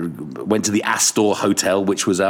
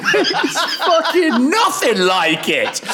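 laughing; slower than usual. Real, not acted. Recalling the rusty ship.

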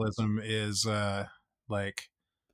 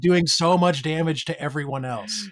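loud, higher-pitched exasperated plea; exasperated outburst; 6 semitones higher, 5 dB louder than usual. Acted. Listener reacting to the bankers' bailout, loudly exasperated. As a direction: raise your voice in emphatic mock appeal.

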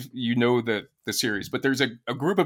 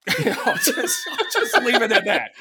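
real laughter breaking through the words, higher pitch; laughing; 9 semitones higher, 3 dB louder than usual. Real, not acted. Laughing at Walken's casting.

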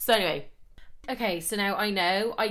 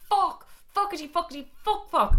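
high-pitched mock-panicked swearing; tiny high yelps of dread; comic panic; 9 semitones higher than usual. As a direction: squeak out the curses, caught out. Retells inner panic at being caught.

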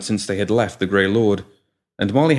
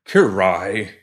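put-on higher, slow and prim old man; testy old gentleman; 7 semitones higher, 4 dB louder, slower than usual. A narrator's reading. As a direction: huff it out like a fussy old gent. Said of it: "the pram retorted testily".

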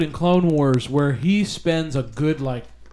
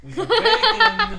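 laughing. Real, not acted. Bacon arrives on the table.

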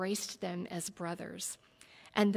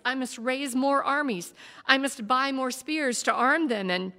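louder, rising heated defence, building; 4 semitones higher, 7 dB louder than usual. A narrator's reading. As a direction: argue harder with each line. Warrior defends himself heatedly.

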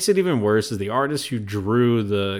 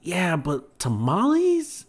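slow, higher-pitched disbelief; incredulous mock quote; 8 semitones higher, slower than usual. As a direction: question it in drawn-out, rising disbelief. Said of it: "and then you're like".

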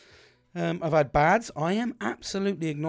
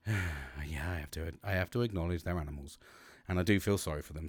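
grudging mock reply; 10 semitones lower, 8 dB quieter than usual. Mocking his own reluctant reply.

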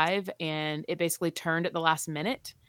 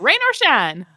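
defiant family; 8 semitones higher, 10 dB louder, slower than usual. Retold or imitated. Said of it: "So it was like".

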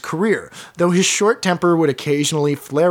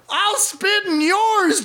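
very high, drawn-out comeback; hammed-up defiance, slow and high; acted angry retort; slower than usual. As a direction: throw the threat back in mock fury. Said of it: "Jurges came back".

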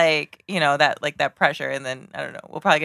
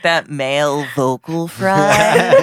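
slow male vocal fry; lazy creaky teen drawl; slower than usual. Pretend.

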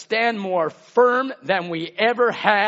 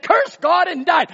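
high, loud mock bitter shout; wife's bitter cry; 9 semitones higher, 6 dB louder than usual. Job's wife telling him to give up, a loud scornful shout.

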